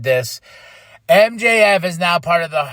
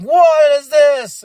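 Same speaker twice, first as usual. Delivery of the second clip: mock outraged fans; 9 semitones higher, 7 dB louder, much slower than usual. Fans mocking new music.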